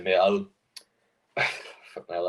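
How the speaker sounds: ordinary talk, nothing marked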